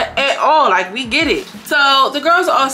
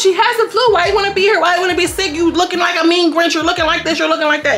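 high-pitched imitated tirade, easing slightly down; mocking rant; 9 semitones higher than usual. Vlogger mimicking a castmate ranting at the sick girl, high mocking tirade. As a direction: pile on the accusations in a high voice.

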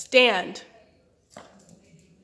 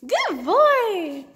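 high, loud praise for a dog; 10 semitones higher, 9 dB louder than usual. Real, not acted. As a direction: gush over the dog, way up high. Praising dog after trick.

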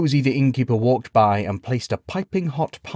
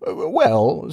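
higher, hesitant, rising; a little defensive; 8 semitones higher, 3 dB louder than usual. A narrator's reading. Knight answers the laughing villagers, a little defensive. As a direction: stammer into a mild protest. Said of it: "said Gawain a little defensively".

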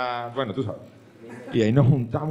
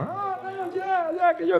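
silly bandmate; slower than usual. Retold or imitated.

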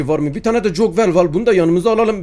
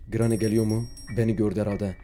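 very soft, tense hiding voice; hushed; 9 semitones lower, 9 dB quieter than usual. Real, not acted. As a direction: barely breathe it out. Hiding from Granny.

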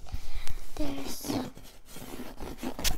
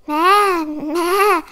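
loud, higher goat maa; goat bleat; 5 semitones higher, 9 dB louder than usual. Acted. Voicing the goat for young listeners, loud bleat. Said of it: "said the goat".

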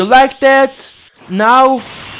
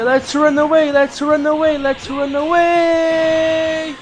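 higher chant with the last word stretched out; sing-song fleeing cry; 4 semitones higher than usual. Pretend. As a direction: chant it higher, then drag out the final "away". Fleeing from the opponent in game.

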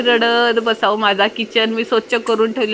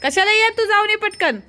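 calling out; 8 semitones higher than usual. Real, not acted. Calling out to someone outside.

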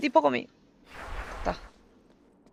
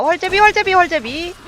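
high, loud repeated target call; excited callout; 8 semitones higher, 4 dB louder than usual. Real, not acted. Calling out target in fight.

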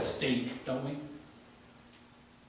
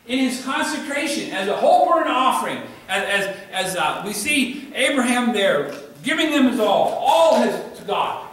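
loud, high, ringing sermon delivery; full-force preaching; 9 semitones higher, 11 dB louder than usual. Acted. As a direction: preach it out to the back pews with fire.

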